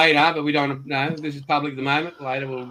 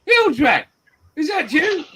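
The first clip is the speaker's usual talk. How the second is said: high-pitched shout of recognition; incredulous, much higher voice; astonished greeting; 12 semitones higher, 5 dB louder, slower than usual. Real, not acted. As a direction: hail a friend in loud disbelief. Hailing a long-absent friend.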